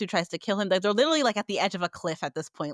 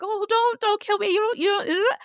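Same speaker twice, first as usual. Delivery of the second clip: high-pitched, hammed-up begging for life; mock tearful pleading; 12 semitones higher, 4 dB louder than usual. Podcaster mimicking the killer begging for his life, mock panicked pleading. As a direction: imitate a coward whimpering for mercy. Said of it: "literally crying for his life".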